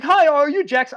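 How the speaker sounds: high, loud cop greeting; imitated cop. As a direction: put on a high-pitched cop at the door. Voices the cop at the door.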